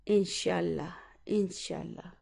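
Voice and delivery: a quiet, heartfelt insha'Allah fading away; soft sincere wish. Real, not acted. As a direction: let it drop soft and sincere. Closing prayerful wish.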